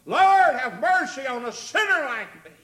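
loud, high impassioned plea; crying out for mercy. Acted. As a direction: sinner calling out to the Lord. Sinner's prayer repeated as a cry.

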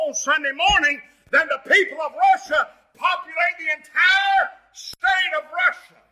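shouted, high-pitched revival preaching; full-force preaching. Acted.

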